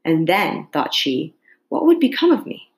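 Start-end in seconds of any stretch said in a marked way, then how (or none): 1.7-2.7 worried. A narrator's reading. Alice worrying about beheading.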